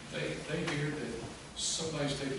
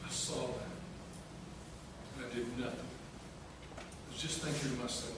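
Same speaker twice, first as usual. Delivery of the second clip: slow, hushed confession, sinking lower; guilty remorse; 3 semitones lower, 6 dB quieter, slower than usual. Acted. Peter recalling that he watched and did nothing, hushed remorse. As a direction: weigh each memory with long pauses.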